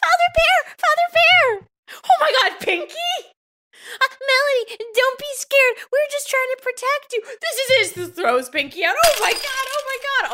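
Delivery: high frantic shrieking; shrieking jackpot winner. Acted. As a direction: shriek it out in panic. Toy thrown out window, panic.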